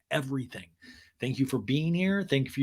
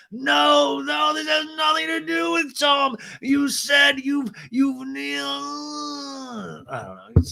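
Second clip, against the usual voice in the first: high, loud babbling wail; hammed-up flustered protest, very high; Lisa; 12 semitones higher, 5 dB louder, much slower than usual. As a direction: babble in a drawn-out, panicky shriek. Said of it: "Lisa's just, like, babbling".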